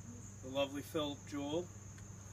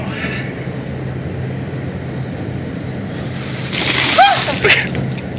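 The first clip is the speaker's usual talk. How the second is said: shocked laughing scream; 21 dB louder than usual. Real, not acted. Ice poured over him.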